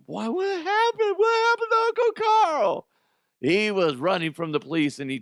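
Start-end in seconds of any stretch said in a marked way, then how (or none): 0.1-2.9 very high falsetto mock wail; hammed-up, very high-pitched pleading; upset young relative. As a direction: squeal it like a distraught little relative. Voices relative asking after uncle.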